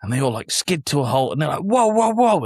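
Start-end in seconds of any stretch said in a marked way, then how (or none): 1.6-2.4 high, loud London roadman whoa; roadman teens. Retold or imitated. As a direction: call it out like a cheeky roadman kid on a bike. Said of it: "talking a little bit roadman".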